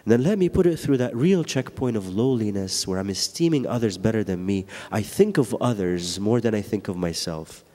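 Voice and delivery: ordinary talk, nothing marked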